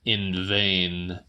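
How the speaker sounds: usual speaking voice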